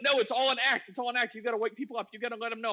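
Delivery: high-pitched imitation of a zealot; urgent insistence. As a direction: press the point like a true believer. Acting friend's urgent insistence.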